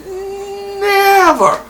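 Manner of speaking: drawn-out high Never; stressed never. Real, not acted. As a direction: hit Never high and long.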